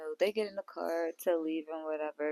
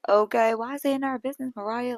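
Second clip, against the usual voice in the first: louder, fast mock rant; going off; 5 semitones higher, 7 dB louder, faster than usual. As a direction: fire it off like she's going off. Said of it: "she was really going off".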